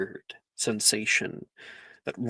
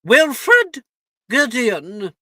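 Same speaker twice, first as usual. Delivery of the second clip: calling out a name; 8 semitones higher, 8 dB louder, much slower than usual. Acted. McGonagall calls name at sorting.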